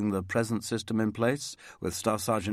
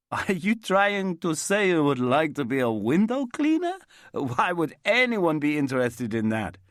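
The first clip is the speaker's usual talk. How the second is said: put-on higher, louder and puzzled, with an Italian accent; bewildered Italian accent; 7 semitones higher, 5 dB louder than usual. A narrator's reading. Roberto baffled by Terry's offer, puzzled Italian accent. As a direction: Roberto can't believe Terry wants the job.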